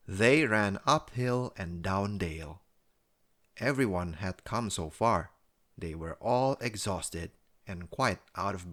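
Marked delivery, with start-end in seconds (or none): none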